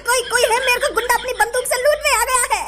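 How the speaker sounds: high, stammering plea for help; panicked pleading. Acted. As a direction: beg anyone nearby to rescue you. Robbery victim begs passers-by. (घबराई, ऊँची आवाज़ में गुहार)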